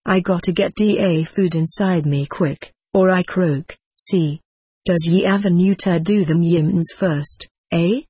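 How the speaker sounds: ordinary delivery, nothing marked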